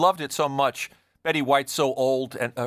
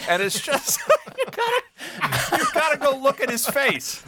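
laughing; 12 semitones higher, 3 dB louder than usual. Real, not acted. Laughing high-pitched about Reiner.

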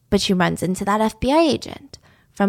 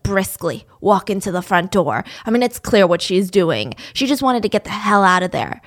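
ordinary talk, nothing marked

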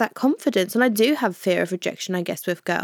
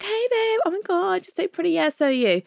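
put-on squeaky, much higher gushing girl; high-pitched bathroom voice; 10 semitones higher than usual.

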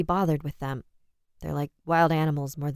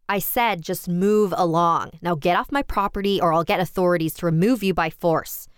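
louder, indignant outburst; angry near-bellow; 2 semitones higher, 6 dB louder than usual. A narrator's reading. Homeowner threatens the vagrant with authorities, near-bellowing. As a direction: order him off your land, loud and angry. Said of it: "I practically bellowed".

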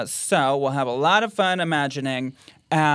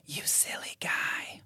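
slow eerie whisper; ghost whisper; 12 dB quieter, slower than usual. Shown as a demonstration. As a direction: breathe it slowly like a ghost. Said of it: "Is it A".

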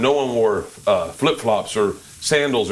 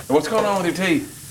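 higher-pitched, badly done Brooklyn accent; hammy attempt at Brooklyn, pitched up; 7 semitones higher than usual. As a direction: imitate a Brooklyn girl, getting the accent wrong. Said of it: "I'm doing a bad accent".

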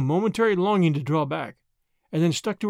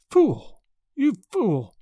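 higher, exclaimed self-scolding; self-reproach; 5 semitones higher, much slower than usual. A narrator's reading. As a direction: scold yourself sharply in the mirror. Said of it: "murmured Tuppence at length, making a grimace".

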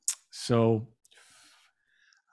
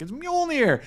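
imitating Thor, jumping up high with joy; overjoyed cry; 12 semitones higher than usual. Thor thrilled to see his hammer again, mock joyful cry. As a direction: squeal it like a kid finding a lost toy. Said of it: "And he's like".

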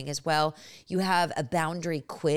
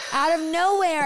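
amazed emphasis; 12 semitones higher, 9 dB louder than usual. Real, not acted. Excited retelling of daughter.

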